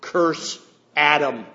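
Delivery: landlord pronounces judgment, dragging out each word; slow stern curse. Acted. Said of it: "he pointed at him. He said".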